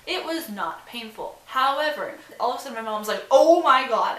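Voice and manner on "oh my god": loud, high panicked cry of a freaked-out mom; mom freaking out. Retold or imitated. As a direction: yell it like a mother who just heard a crunch. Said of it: "and starts freaking out".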